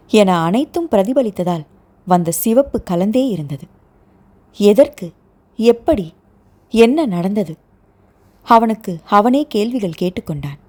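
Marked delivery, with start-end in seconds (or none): none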